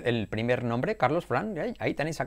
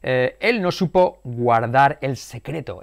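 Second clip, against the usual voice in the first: ordinary talk, nothing marked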